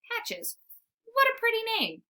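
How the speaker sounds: a bright high puppy crush, lightly acted; smitten pup. A narrator's reading. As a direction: gush at her name like a love-struck pup. Smitten pup admiring Patches.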